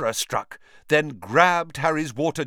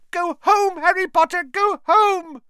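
higher, louder elf plea; squealing house elf; 7 dB louder than usual. Acted. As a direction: shriek at him to go home. Repeated plea.

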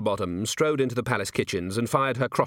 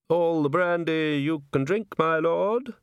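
slow, high, coaxing voice; wheedling; 6 semitones higher, slower than usual. A narrator's reading. A chair tempts Nobby with brandy, wheedling. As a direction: tempt him oilily, lilting up at the end. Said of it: "said a wheedling voice".

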